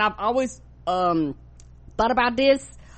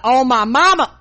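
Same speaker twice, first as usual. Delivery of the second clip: mock threat; 3 semitones higher, 7 dB louder than usual. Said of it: "Somebody be like".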